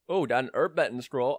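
ordinary delivery

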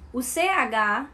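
normal talking voice

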